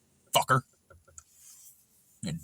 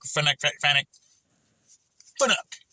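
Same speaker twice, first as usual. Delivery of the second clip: normal talking voice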